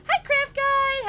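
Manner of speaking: high, loud, drawn-out hello; excited greeting. Real, not acted. Streamer greets a viewer just arriving, excited and high. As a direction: sing out a big stretched welcome.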